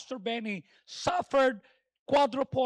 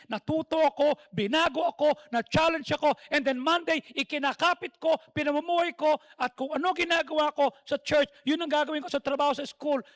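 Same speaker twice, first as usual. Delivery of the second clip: louder, driving sermon build; full-force preaching; 5 dB louder than usual. Acted. Preacher rousing the congregation with testimony, full-force preaching.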